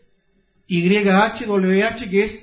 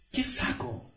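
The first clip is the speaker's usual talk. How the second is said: whispered question; 10 dB quieter than usual. Acted. Imagined inner question.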